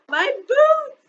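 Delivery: put-on high, loud child's cry; little girl crying out for her boots. A narrator's reading. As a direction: wail it in alarm. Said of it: "she cried".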